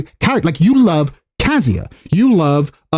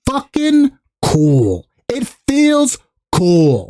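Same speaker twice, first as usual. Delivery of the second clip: awestruck hype; 2 semitones higher, much slower than usual. Acted. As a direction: stretch every word out in wonder. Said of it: "typically it's like".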